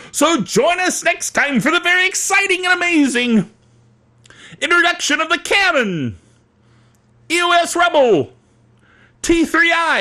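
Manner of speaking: high-pitched announcer hype; hyped show teaser. Acted. Host plugging next time's camera debut, hyped announcement.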